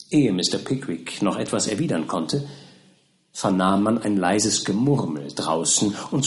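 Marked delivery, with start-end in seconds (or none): none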